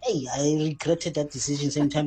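ordinary talk, nothing marked

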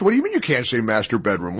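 fast, disbelieving retort; incredulous. Retold or imitated. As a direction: fire it back in disbelief. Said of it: "I said".

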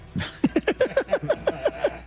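high-pitched burst of laughter; laughing. Real, not acted. Caller predicting a big loss for his team, laughing. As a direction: laugh in a long high run.

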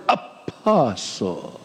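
"apostle" stretched out very slowly, dropping low and soft; hushed, self-important "apostle", long and lingering; emphasis on "apostle". Acted. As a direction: draw out every syllable of "apostle" with mock reverence. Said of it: "put emphasis on that word".

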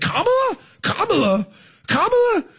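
mock rant by a fed-up coach; Jim Mora voice. Mocking Kamala like a fed-up coach, exasperated yelps. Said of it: "did a Jim Mora voice".

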